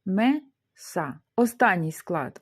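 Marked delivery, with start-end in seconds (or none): 0.1-1.2 "Меса" split in two, pause between syllables. Shown as a demonstration. Teacher showing the two syllables, syllable by syllable. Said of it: "у нас два склади".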